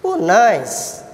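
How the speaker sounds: ordinary talk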